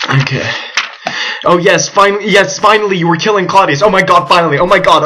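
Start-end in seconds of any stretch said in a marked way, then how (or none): 1.4-5.0 excited. Real, not acted. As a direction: cheer it out, finally. Player finally kills Claudius.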